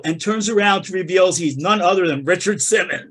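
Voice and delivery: normal talking voice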